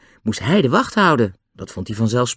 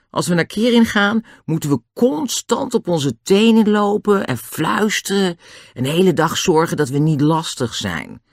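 high, lightly voiced grumble; a raised, put-upon character voice; complaining George; 7 semitones higher than usual. George explains life with a scientist father, complaining.

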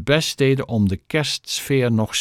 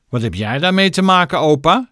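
high, quick, cheeky jeer; mocking; 7 semitones higher, 5 dB louder than usual. A narrator's reading. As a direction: sneer at the old man. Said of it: "spotte Rosamond". (spottend, snel en hoog)

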